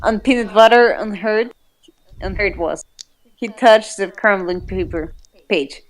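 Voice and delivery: usual speaking voice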